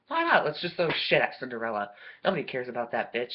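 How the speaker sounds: ordinary delivery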